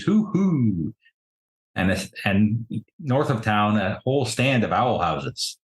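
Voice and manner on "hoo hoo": high-pitched owl-like hooting. Acted. Game master quoting owl folk songs, hooting. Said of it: "repetition of the phrase".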